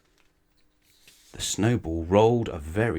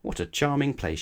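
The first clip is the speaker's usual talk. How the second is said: mock shivering, a touch higher; 6 semitones higher than usual. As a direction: brave face while cold. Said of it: "she said, shivering".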